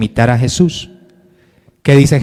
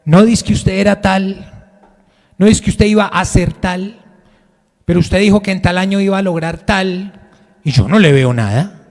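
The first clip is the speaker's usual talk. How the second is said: higher, taunting voice of a skeptic; mocking doubter; 5 semitones higher than usual. Critics taunting a believer, higher and scornful. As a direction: needle them like a scoffing critic.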